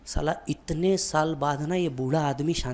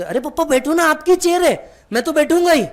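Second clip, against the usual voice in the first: high, loud coaxing that stretches the words; pleading insistence; 11 semitones higher, 6 dB louder than usual. Retold or imitated. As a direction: beg dad warmly to sit in your chair. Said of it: "आप बोलते". (ऊँची आवाज़ में ज़िद भरी मनुहार)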